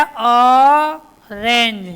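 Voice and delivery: Orange stretched out very slowly and loudly, every sound drawn out; slow drawn-out demo. Shown as a demonstration. Teacher modelling orange for young pupils, slow and loud. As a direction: draw out orange syllable by syllable for the class. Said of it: "ओ पर क्या आया?".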